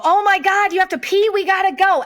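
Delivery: high, hammed-up urgent alarm; mother faking urgency to her son to flee; mock panic. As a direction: fake a frantic emergency to escape. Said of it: "I look at Beckett, and I go".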